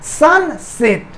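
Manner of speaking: Sunset in two slow, separated beats; split into syllables. Shown as a demonstration. Teacher showing students the two parts, split into syllables. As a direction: break sunset after सन, stretching each part. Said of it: "दो हिस्सा में बट रहा है".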